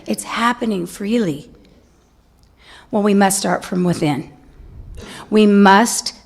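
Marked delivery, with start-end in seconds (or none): none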